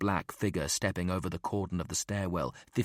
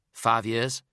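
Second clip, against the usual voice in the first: higher, louder, rising answer; South African answer; 4 semitones higher, 5 dB louder than usual. A narrator's reading. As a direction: answer a little defensively. Partner answers.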